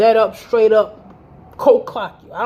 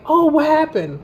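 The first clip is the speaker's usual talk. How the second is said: mock innocent; 7 semitones higher than usual. Mocking feigned innocence.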